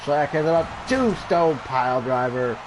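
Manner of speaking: hyped play-by-play as the pin lands; excited finish call. Acted. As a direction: build to the big finish like a ringside caller. Calling the finishing move.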